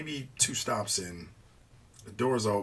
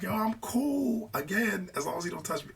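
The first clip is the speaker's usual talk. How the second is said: insistently cool; 9 semitones higher than usual. Retold or imitated. Telling his friend he's cool unless touched, high-pitched insistence. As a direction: act out insisting you're fine, voice pitched way up.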